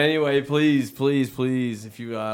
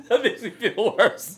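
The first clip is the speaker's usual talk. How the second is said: very high laugh into the words; real laughter; guilty laugh. Real, not acted. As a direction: laugh through an embarrassed apology.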